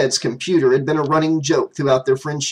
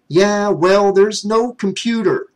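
retort; 6 semitones higher, 3 dB louder, slower than usual. Acted. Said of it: "Jimmy retorted".

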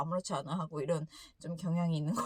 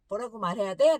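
louder pressing demand from a mother; mother demanding her son say something, louder scolding; scolding mom; 8 dB louder than usual. Retold or imitated. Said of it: "엄마가 다그쳤을 때".

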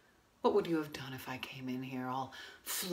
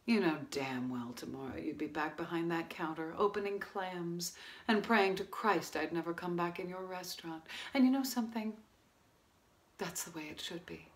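quiet bitterness; 2 semitones lower, 8 dB quieter than usual. Acted. Woman confronts restaurant owner after failed affair, quietly bitter. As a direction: confront him softly, with long pauses.

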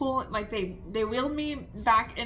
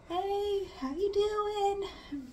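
much higher, slow, sing-song hello; gentle nurse greeting; 11 semitones higher, 3 dB quieter, much slower than usual. Retold or imitated. Nurses greeting her in recovery, high and slow sing-song. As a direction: coo it like a nurse waking a patient.

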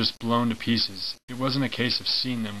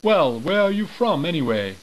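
high, loud British sergeant; British accent; 7 semitones higher, 4 dB louder than usual. Acted. British sergeant demanding where the soldiers come from, loud British accent. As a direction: put on a British accent and raise the voice on Well. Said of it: "I should be doing this in a British accent".

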